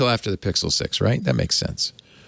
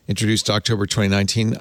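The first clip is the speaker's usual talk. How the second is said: ordinary talk, nothing marked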